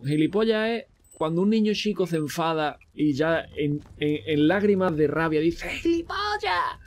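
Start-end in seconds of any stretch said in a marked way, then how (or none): 5.7-6.8 put-on very high, slow childish wail; angry crying kid. Streamer imitates a crying angry child, very high and drawn out. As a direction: wail it like a furious little kid in tears. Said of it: "insulto de niño chico llorando enfadado".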